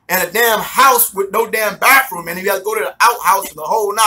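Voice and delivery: ordinary delivery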